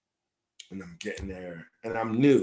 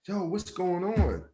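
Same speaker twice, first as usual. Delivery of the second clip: high, puzzled question; pitched-up, baffled reaction; retold bewilderment; 8 semitones higher than usual. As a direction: re-enact being taken aback. Retold reaction to many missed messages.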